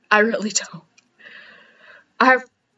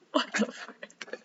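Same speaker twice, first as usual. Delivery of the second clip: breathless whispered laugh, slow; whispered giggle; 15 dB quieter, much slower than usual. Real, not acted. Giggling at herself in the mirror, whispered laughing. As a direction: laugh it out under her breath. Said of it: "I can't stop giggling".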